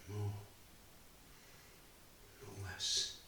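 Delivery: quiet, measured, heavy with loss; a father recalling betrayal and his wife's death; grieving father's monologue. Acted. A father telling the audience of loss, subdued and grieving. Actor performs Bob's final monologue.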